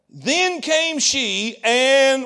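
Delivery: slow, stretched verse reading; slow, suspenseful scripture reading; drawn-out reading. Real, not acted. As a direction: draw out every word, leaving the last one hanging.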